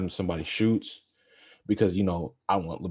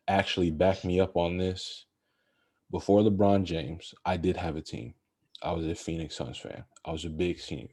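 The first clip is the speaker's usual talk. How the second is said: ordinary talk, nothing marked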